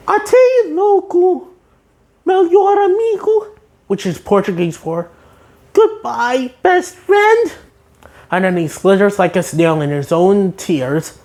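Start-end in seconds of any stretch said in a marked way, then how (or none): 0.1-7.5 SpongeBob farewell. Retold or imitated. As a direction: say goodbye in a high sponge voice. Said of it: "And then SpongeBob says".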